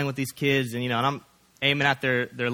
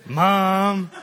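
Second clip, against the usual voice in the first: higher, louder groan of mom; embarrassed kid; 6 semitones higher, 5 dB louder than usual. Retold or imitated. Boys embarrassed by their mom, groaning mom. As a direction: whine at your mother. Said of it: "they're just".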